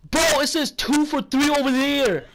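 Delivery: loud, high-pitched mock complaint; retold bitching.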